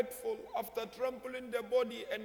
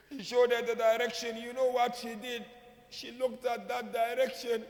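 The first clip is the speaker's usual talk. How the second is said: louder, rising sermon voice that builds to a cry; impassioned majlis preaching; 3 dB louder than usual. Acted. Preacher to mourners, pouring out the tragedy loudly. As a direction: preach the tragedy at full force.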